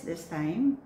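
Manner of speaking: ordinary delivery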